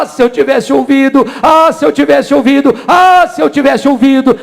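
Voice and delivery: high, loud cry of regret; anguished shout. Acted. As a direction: shout it as the condemned sinner would. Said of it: "você vai chorar e gritar". (grito alto de arrependimento desesperado)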